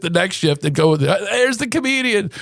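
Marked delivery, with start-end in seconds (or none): 1.3-2.3 lightly voiced dad, pitched up; high imitation of dad hailing his son; greeting father. Dad shows off his comedian son on mule ride, hailing.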